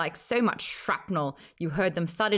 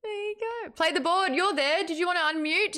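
coaxing; 11 semitones higher than usual. Real, not acted. Coaxing guest to speak.

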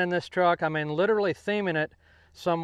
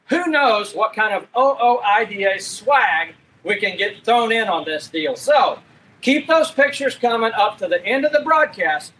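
upbeat, higher-pitched broadcast presenter read; show host announcer; 4 semitones higher, 5 dB louder than usual. Acted. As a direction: punch out the contest promo to the audience. Host presents a streamed show segment.

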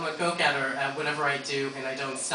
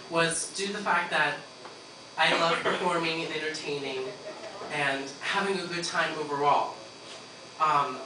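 ordinary delivery

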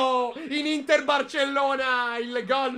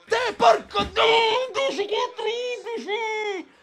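angry shout; 9 semitones higher, slower than usual. Real, not acted. Angry fan outburst.

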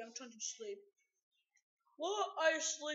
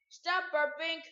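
louder scolding; 3 semitones higher, 7 dB louder, slower than usual. Pretend.

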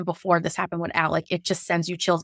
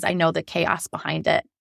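usual speaking voice

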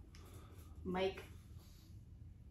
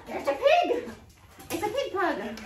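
very high-pitched pet talk; talking to the dog; 9 semitones higher, 6 dB louder, slower than usual. Real, not acted. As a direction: squeal playfully about the pug. Teasing pet talk about the pug.

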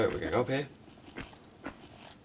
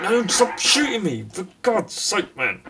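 higher, louder, slowed protest; real frustrated shouting; exasperated yell; 9 semitones higher, 5 dB louder, slower than usual. Real, not acted. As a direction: shout at the Frenchman to quit it. Being shot again.

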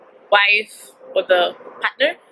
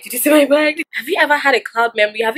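frantic pleading; 4 semitones higher than usual. Retold or imitated. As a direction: beg them, voice rising in panic. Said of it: "I was like a mad woman".

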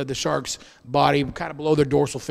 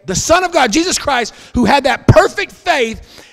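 loud, high, climbing sermon peak; rising preacher's cry; preaching at full force; 8 semitones higher, 7 dB louder than usual. Acted. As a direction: preach it up to a ringing climax.

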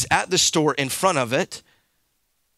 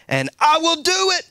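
high, loud ringing finish; preacher's climax; 12 semitones higher, 4 dB louder than usual. Acted. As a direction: lift it to the rafters.

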